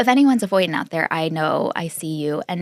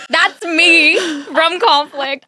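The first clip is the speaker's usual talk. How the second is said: Forrest Gump drawl; 6 semitones higher, slower than usual. Retold or imitated. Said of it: "he's like".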